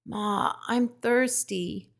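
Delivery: high, slow, faint little plea; weak gosling. A narrator's reading. Young goose asking his robot mother for water, faint. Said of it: "he whispered".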